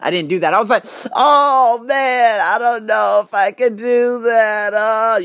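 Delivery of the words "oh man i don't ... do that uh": high, slow, unsure whine; mock indecisive answer to the boss; wishy-washy hesitation. Shown as a demonstration. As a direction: waver like you can't say no. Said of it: "that's when, of course, the extortionists".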